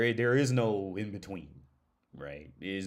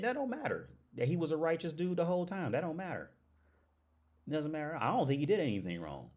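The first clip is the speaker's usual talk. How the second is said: ordinary talk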